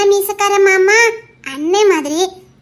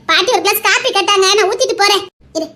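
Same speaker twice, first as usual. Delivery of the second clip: ordinary talk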